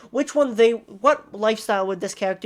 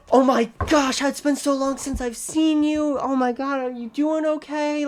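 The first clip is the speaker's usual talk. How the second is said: excited greeting; 5 semitones higher than usual. Retold or imitated. Shy person meeting a friend again, excited gush. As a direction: gush over an old friend. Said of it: "they're like".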